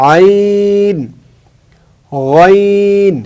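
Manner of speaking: teacher reviewing letters aloud; letter review demo. Shown as a demonstration. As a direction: stretch আইন and গইন slowly, throat sounds. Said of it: "তাহলে আমরা শিখলাম".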